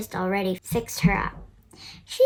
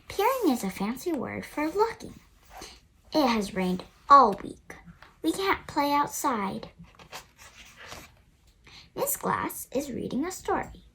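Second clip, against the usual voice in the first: ordinary delivery, nothing marked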